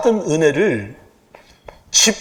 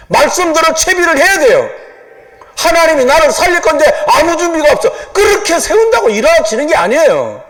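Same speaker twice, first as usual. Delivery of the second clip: fervent preaching; 3 semitones higher than usual. Acted. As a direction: drive it home to the congregation. Preaching at full force.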